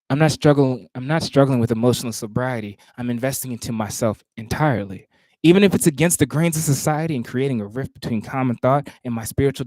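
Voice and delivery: measured, rhythmic poetry recitation; spoken word performance cadence; spoken word poem. Acted. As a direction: perform the poem with weight on each phrase.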